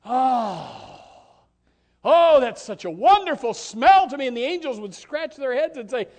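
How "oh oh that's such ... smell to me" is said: rising, louder, savouring the smell; a pleased God, swelling; God voiced with delight. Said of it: "and God would say".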